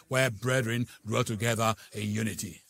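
ordinary talk, nothing marked

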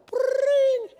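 high trilled telephone ring; imitated phone ring. Phone rings at five thirty, high trill. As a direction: trill it shrilly like a ringing phone.

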